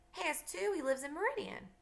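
put-on high-pitched childish comeback; indignant contradiction. Child contradicts taunting cousin.

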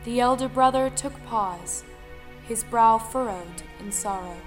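ordinary delivery, nothing marked

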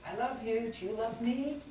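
mock the needy sweetheart, softly; lightly voiced clingy lover asking for love.

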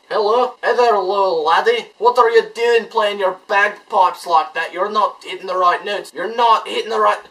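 put-on Scottish accent. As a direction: scold the little laddie like a hammed-up Scotsman.

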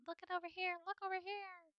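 high, softened imitation of yelling; mock yelling. Player imitating his gaming partner, high mock yell. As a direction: mimic a distant yell without real volume. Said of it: "a whole bunch of him yelling".

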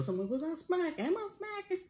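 high teasing mimic voice; mocking imitation. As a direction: mimic her in a high whiny voice. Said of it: "you gonna ask on that other video".

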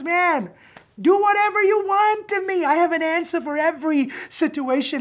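high, loud and fearless; defiant joy. Acted. Paul telling the guards to do their worst, exultant. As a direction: dare them, beaming.

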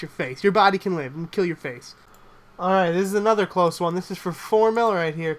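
ordinary delivery

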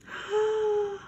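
sharp high gasp; surprised gasp. Acted. Children finding Mondy, high surprised gasp. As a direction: gasp at the discovery. Discovering the hen.

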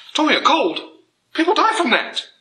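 very high-pitched squeaky disbelief; mock incredulity. Mock outrage at quoted excuse.

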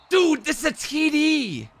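high, slow, stretched-out shout; excited shout. Real, not acted. Streamer yelling at a near touchdown, excited shout. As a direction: yell it like the play just scored. Yells at a play in game.

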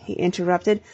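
plain reading voice

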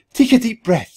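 high, loud sisterly screech. A narrator's reading. Sister reviving her fainted brother, screeching. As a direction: screech it urgently, pitched right up. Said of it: "she screeched".

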